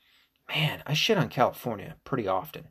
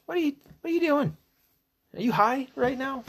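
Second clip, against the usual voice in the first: very high, incredulous squawk; baffled by the well wishes; hammed-up shrieking disbelief; mock outrage. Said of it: "And I'm like".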